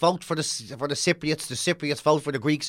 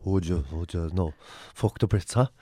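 much lower gruff lusty voice; mock lusty rebel; 8 semitones lower than usual. A lusty Irish rebel to his lover, low and gruff. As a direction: growl it low like a randy rebel.

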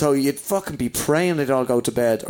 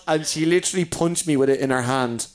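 ordinary talk

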